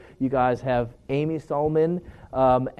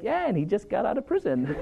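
higher, laughing punchline; laughing through the quote; 8 semitones higher than usual. Retold or imitated. The roommate delivers the prison news to his mom, laughing, voice pitched higher. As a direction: break into a laugh on the words. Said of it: "and then he's like".

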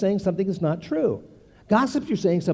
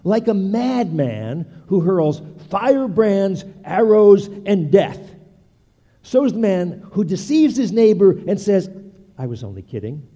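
slow, louder dramatic proverb reading; scripture reading; 4 semitones higher, 7 dB louder than usual. Acted. Preacher reading a proverb to the congregation, slow and dramatic. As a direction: read the proverb with weight, building. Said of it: "Proverbs twenty-six eighteen".

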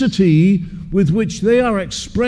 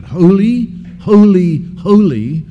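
each holy pushed out louder; emphatic triple holy; 5 dB louder than usual. Shown as a demonstration. Preacher stressing holy three times to the congregation. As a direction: hammer home the repeated holy. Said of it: "what is being emphasized is that quality".

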